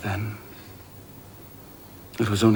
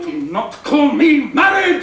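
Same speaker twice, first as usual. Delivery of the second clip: much higher, loud, slow angry cry; furious shout; 6 dB louder, slower than usual. Acted. Rochester shouts down Jane's objection, furious shout. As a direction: roar the denial at her.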